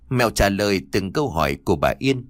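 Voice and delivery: ordinary delivery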